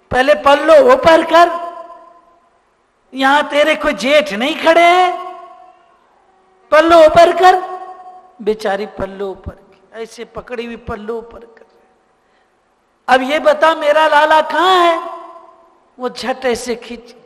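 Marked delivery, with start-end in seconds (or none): 0.1-7.6 higher, louder stern scold. Acted. Yashoda scolding the bride, loud scold. 13.1-15.1 louder, sharp demand. Acted. Yashoda demanding her son back, sharp.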